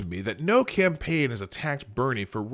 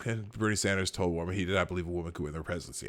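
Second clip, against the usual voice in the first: low, quiet, rushed mumble; mumbled; 7 semitones lower, 8 dB quieter, much faster than usual. Real, not acted. Host skimming a report already covered, mumbled. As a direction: race quietly through the words. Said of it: "I mumbled the words anyway".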